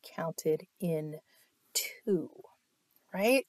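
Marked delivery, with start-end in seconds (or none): none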